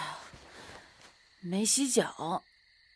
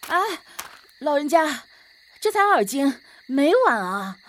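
又高又响地争辩; 急着喊冤说没晚; 急切高声辩解; 9 semitones higher, 9 dB louder than usual. Acted. 急切争辩没来晚. (高声急切地辩解)

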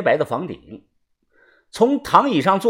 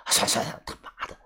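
near-whispered grumbling; muttered curse; 6 semitones lower, 12 dB quieter than usual. A narrator's reading. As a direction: give up, swearing under your breath. Prisoner gives up, curses under breath.

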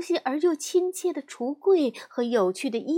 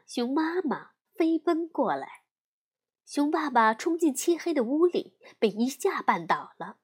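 plain reading voice

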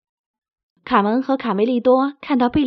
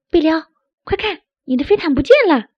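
high, loud, fast alarmed call; crying out; 6 semitones higher, 6 dB louder, faster than usual. Acted. Carmen telling Berio his carpet is gone, quick loud alarm. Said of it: "卡门大叫".

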